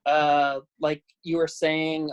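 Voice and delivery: ordinary talk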